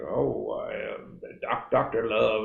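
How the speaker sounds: [name] demon voice. Retold or imitated. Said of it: "adopts this sort of demon voice".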